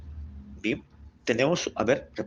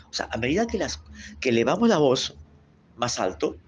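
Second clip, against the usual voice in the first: ordinary talk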